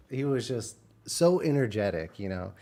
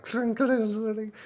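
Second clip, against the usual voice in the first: imitated clatter; 11 semitones higher, slower than usual. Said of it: "It's like".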